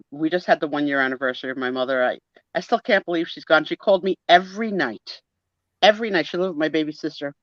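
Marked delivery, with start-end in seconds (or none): none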